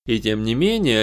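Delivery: usual speaking voice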